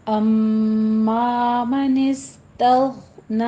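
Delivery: slow measured Quranic chant; tajweed recitation. Shown as a demonstration. Teacher models the verse for students, slow recitation. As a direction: draw each vowel out like a reciter.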